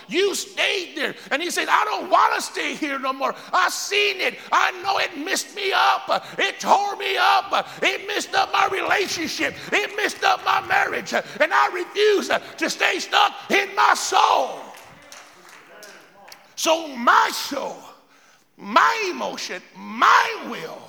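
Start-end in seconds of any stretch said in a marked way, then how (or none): 1.7-14.6 loud, high, fervent shout. Acted. Preacher voices the man's resolve. 16.5-20.8 preaching. Real, not acted. Preaching at full force.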